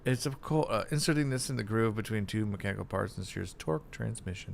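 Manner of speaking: fast, rushed read-out; rapid reading. Real, not acted. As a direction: rattle it off quickly. Reads game text fast.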